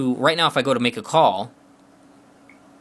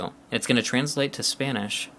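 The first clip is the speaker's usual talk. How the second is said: ordinary delivery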